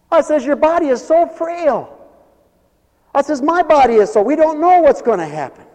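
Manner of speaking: high, loud, full-force exhortation; impassioned preaching plea. Acted. Preacher pleading with John about frail life, impassioned shouting. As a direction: preach it urgently at the top of your voice.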